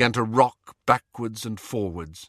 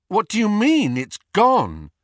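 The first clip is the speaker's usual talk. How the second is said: higher, louder, stunned demand; loud, shocked question; disbelieving demand; 10 semitones higher, 7 dB louder than usual. Acted. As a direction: push back in disbelief. Harry stunned by the loss.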